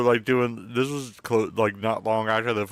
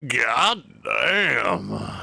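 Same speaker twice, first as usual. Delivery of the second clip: mock toilet exclamation. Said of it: "it's like, plop".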